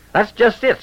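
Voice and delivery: put-on high, flustered sputter of an old rancher. A narrator's reading. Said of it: "sputtered Clint".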